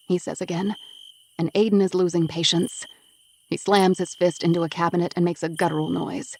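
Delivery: usual speaking voice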